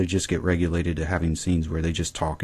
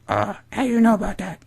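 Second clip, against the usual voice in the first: high-pitched mock mother voice; Daisy; 3 dB louder than usual. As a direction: imitate a wary mom in falsetto. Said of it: "She's like".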